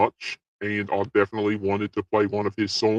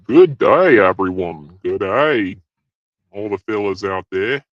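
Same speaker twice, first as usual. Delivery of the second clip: ordinary talk, nothing marked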